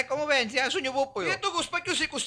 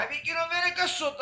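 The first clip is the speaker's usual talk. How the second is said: normal talking voice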